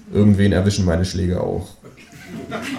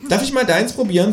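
indignant kangaroo; 13 semitones higher than usual. Acted. Kangaroo outraged at eating table decoration, high voice. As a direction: huff at the stupid decoration. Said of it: "fragt es".

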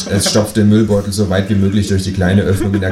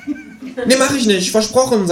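high, cheeky kangaroo; slower than usual. A narrator's reading. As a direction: pitch way up for the kangaroo. Kangaroo character voice.

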